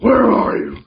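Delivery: a light jokey Batman copy; mock Batman voice. Teasing a friend by echoing his Batman voice. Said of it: "why we're using that voice".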